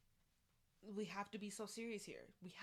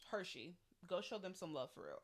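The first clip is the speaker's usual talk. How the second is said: normal talking voice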